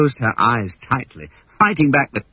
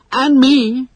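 chorused; 14 semitones higher, 5 dB louder than usual. Acted. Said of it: "chorused the lost boys".

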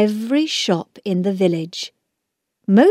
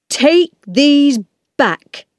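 slow, raised angry voice, higher and louder; angry shout; 7 semitones higher, 5 dB louder, slower than usual. A narrator's reading. Angry man refuses birthday gifts from children, shouting. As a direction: order it slowly in an understated shout. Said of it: "he shouted".

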